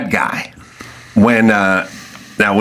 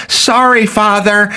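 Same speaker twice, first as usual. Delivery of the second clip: put-on high, small apologetic kid voice; mock contrite daughter; meek child; 11 semitones higher, slower than usual. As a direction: say sorry like a scolded child. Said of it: "child answers the mom".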